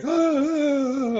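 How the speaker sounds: long, loud, high mock wail; drawn-out cry of dismay, hammed up; horrified groan. Acting out shock hearing the album.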